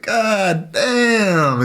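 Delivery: high, drawn-out fed-up curse; exasperated groan. Retold or imitated. To a friend who won't stop talking, a high, drawn-out exasperated curse. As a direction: let it sag with annoyance. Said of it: "It's like".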